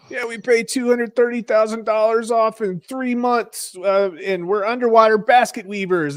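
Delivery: high, excited radio caller voice. Retold or imitated. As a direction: gush like a thrilled caller bragging about their payoff. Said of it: "They're like".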